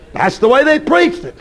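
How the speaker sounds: usual speaking voice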